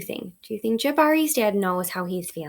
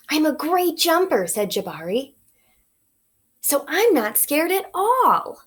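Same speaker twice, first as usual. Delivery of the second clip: put-on boastful boy; 5 semitones higher than usual. Jabari boasting, voiced higher.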